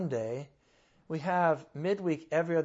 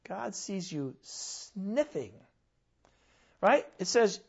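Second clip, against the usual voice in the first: ordinary talk, nothing marked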